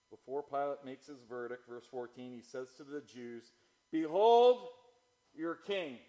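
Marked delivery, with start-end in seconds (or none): 3.9-6.0 loud, high mocking proclamation that drops away; scornful mockery. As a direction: proclaim it in ridicule, hitting the first word hard. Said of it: "More scorn, more mockery, ridicule, sarcasm".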